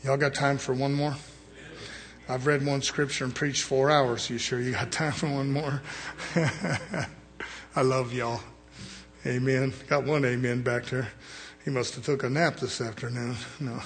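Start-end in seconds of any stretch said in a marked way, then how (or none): none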